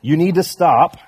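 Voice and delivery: hammed-up stern shout; Mama's shout. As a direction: put your foot down as Mama Bear. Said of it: "she has shouted and said".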